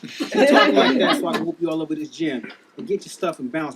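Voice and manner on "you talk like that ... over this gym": loud laughing threat. Acted. Trainer threatening the gym guy.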